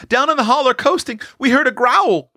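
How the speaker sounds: put-on high, quick frightened child's voice; high-pitched breathless-with-news telling; wide-eyed scared boy. A narrator's reading. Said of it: "eyes as big as saucers".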